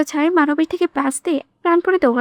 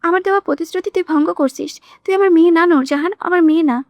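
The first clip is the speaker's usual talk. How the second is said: usual speaking voice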